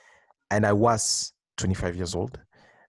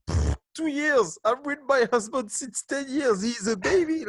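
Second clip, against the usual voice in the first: high mocking voice, pitched way up; dismissive mock listener, high and sneering; scoffing listeners; 13 semitones higher than usual. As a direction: scoff at the two years like a smug long-married wife. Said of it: "I can hear so many people now listening to that".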